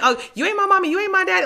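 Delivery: put-on high sassy kid voice, laughing through it; mock a defiant child, pitched high; bratty kid. To her parents, imitating a child talking back, high and sassy. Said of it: "you know how you like".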